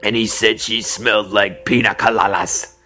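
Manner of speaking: lightly put-on voice; Manny's voice. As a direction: slide into his voice a little. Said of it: "and he talked like this".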